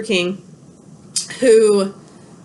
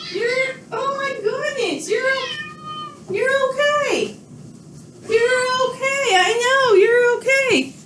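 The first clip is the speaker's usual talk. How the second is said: very high, slow cooing with a catch of a cry; 13 semitones higher, slower than usual. Real, not acted. Owner cooing to her old cat after the dogs chased her.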